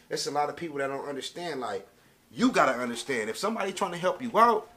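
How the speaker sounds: ordinary talk, nothing marked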